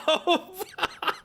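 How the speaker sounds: bursting out laughing high; helpless high-pitched laugh; high laughter. Real, not acted. Amused by own yelling bit.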